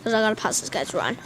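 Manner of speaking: usual speaking voice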